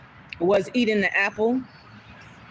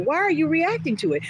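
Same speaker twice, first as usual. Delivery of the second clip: ordinary delivery, nothing marked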